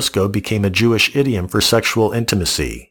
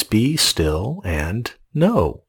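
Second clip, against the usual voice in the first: slow quoted scripture; 3 dB quieter, much slower than usual. Acted. Quoting God's word slowly.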